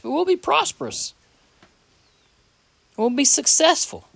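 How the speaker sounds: lifted high and ringing on the promise; climbing pitch, fervent preacher's lift; rising preaching emphasis. Real, not acted. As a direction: proclaim it like good news to the congregation. Preacher stressing God's promise.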